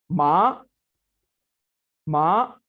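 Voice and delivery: माँ rising in pitch, said loud; second tone. Shown as a demonstration. Teacher demonstrating the rising second tone on माँ. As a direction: let माँ climb upward like a question. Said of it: "तो देखते हैं कैसे अदा करना है".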